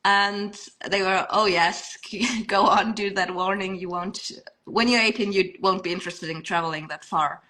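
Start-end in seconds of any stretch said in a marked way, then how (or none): none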